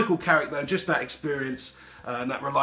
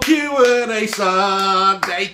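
loud, drawn-out announcer-like call; rousing show opener; 6 semitones higher, 9 dB louder, much slower than usual. Real, not acted. Host opening Q and A Sunday, rousing loud call. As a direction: belt out a long, high welcome.

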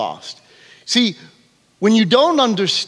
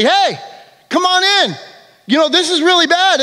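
calling rescuer; 10 semitones higher than usual. Acted. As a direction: call him in from the hatch. Submarine crew calls to man.

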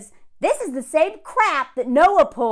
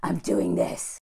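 mock stubborn husband; 9 semitones lower, 9 dB quieter than usual. Mocking the husband refusing to back down, sulky. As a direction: lower and softer, sulky insistence. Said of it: "He's like".

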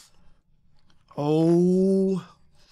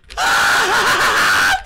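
loud, high burst of laughter; shrieking laugh after a big win; ecstatic laugh; 10 semitones higher, 4 dB louder than usual. Real, not acted.

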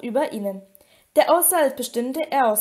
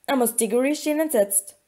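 ordinary delivery, nothing marked

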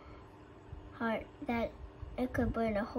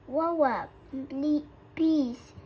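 loud, mangled "Wawa, please"; burned tongue; 3 semitones higher, 7 dB louder, much slower than usual. A narrator's reading. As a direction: beg through a scorched tongue.

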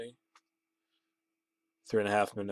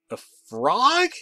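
much higher, louder whiny "A frog?"; whiny shriek; 5 dB louder than usual. Acted. Narrator answering the caller in disbelief, a high whiny shriek. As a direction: shriek "A frog?" like a whiny boy whose voice hasn't broken. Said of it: "I shrieked in a whiny, emasculated, prepubescent-esque voice".

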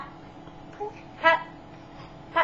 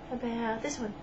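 ordinary talk, nothing marked

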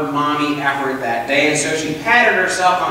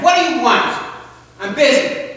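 snapping; 8 semitones higher than usual. Acted. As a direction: bite off the words like an annoyed mom. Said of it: "she snapped".

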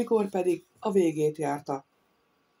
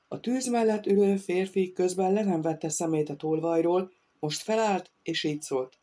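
usual speaking voice

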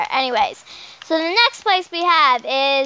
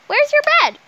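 much higher mock viewer question; imitating a viewer; 13 semitones higher, 3 dB louder, faster than usual. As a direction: pipe up like a curious viewer. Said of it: "'Cause you're probably wondering".

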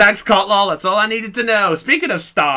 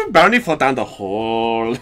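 mock little kid; 10 semitones lower than usual. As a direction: read the meme like a small child. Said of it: "making jokes on things".

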